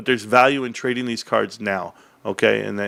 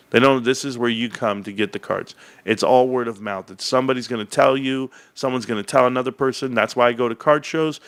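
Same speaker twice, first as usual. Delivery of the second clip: ordinary delivery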